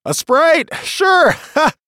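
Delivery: high mocking laugh; laughing disbelief. A narrator's reading. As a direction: scoff at the Sprite with a laugh. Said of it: "said his friend".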